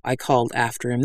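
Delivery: usual speaking voice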